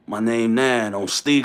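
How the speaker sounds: a hint of an old granddaddy introducing himself. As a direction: stretch the name out like a slow old man. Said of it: "like, his granddaddy voice".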